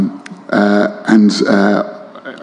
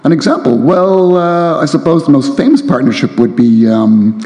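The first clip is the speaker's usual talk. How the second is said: lively reading voice, a little higher; reading aloud; 3 semitones higher than usual. A narrator's reading. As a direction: read the novel with relish to the crowd. Author reads novel excerpt aloud.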